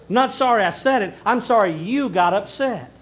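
I'm sorry you got upset, said loud and pointed; blunt hidden meaning. Retold or imitated. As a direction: spell out the real meaning with an edge. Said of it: "use that term sorry to mask".

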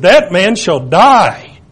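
slow, loud and final; angry verdict. Retold or imitated. King David condemning the rich man, slow angry verdict. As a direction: pronounce the death sentence in anger. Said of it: "and David gets mad".

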